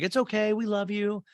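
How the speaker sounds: high-pitched coddling parent voice; mock doting parents. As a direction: coo forgiveness like an indulgent parent. Imitates parents soothing their son.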